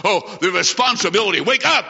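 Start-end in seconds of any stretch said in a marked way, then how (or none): 1.4-1.9 higher-pitched exhortation; urgent call. Real, not acted. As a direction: call out to rouse the congregation. Preacher calls congregation to wake up.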